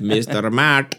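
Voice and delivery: put-on, jokey Indian accent. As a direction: try out a mock Indian accent. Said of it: "I can do a better Indian accent".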